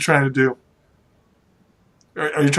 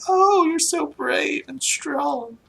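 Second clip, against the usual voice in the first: high, slow gushing lady; drawn-out high-pitched admiration; adoring noble wife; 13 semitones higher, much slower than usual. Acted. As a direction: swoon over your heroic husband. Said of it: "And she's like".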